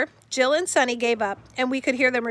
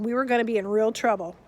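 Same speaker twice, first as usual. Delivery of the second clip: plain reading voice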